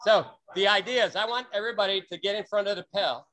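normal talking voice

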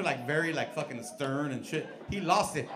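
ordinary talk, nothing marked

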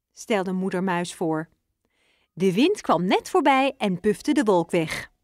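plain reading voice

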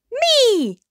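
loud, high, drawn-out self-announcement; boastful cry. A narrator's reading. Hippo claiming the thanks, a loud high boastful cry. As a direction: sing out proudly, claiming all the credit.

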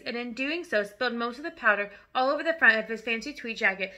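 usual speaking voice

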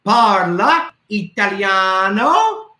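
"Parla Italiano?" slow with a rising question lift; teacher asking the kids "Parla Italiano?"; asking tune. Shown as a demonstration. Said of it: "It's a question for you".

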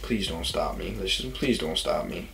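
soft pleading under the breath; nervous plea. Retold or imitated. Teen sneaking past troopers, softly pleading. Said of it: "I was like".

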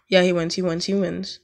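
ordinary delivery

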